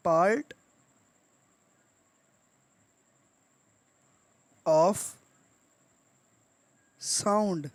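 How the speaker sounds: one word at a time, long pauses between; word-by-word dictation. Shown as a demonstration. Teacher giving learners the meaning of syllable, word-by-word dictation. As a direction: dictate it very slowly, each word standing alone.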